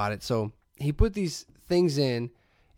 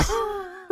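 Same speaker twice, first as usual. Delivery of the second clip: high crying laugh. Real, not acted. Laughing at the story.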